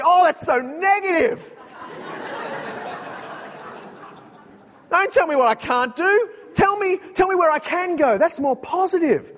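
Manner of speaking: high, indignant mock whine; whiny complaint. Skier protesting the ski rules in a whiny mock voice. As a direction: whine like a spoiled skier who won't be told.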